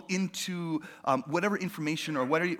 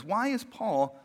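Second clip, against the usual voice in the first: normal talking voice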